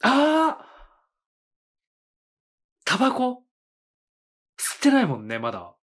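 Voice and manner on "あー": ordinary delivery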